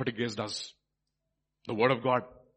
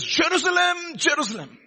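high, loud cry of lament; vehement lament; 10 dB louder than usual. Acted. Jesus weeping over Jerusalem, vehement lament. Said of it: "He's weeping vehemently".

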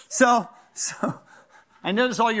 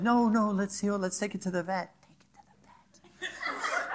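gentle wife imitation; 8 dB quieter than usual. Imitating his wife about animals.

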